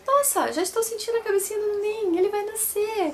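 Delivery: high, lively voice of the nurse; nurse quote. Retold or imitated. Mother retells the nurse announcing the birth, high and lively. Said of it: "ela me examinar dela".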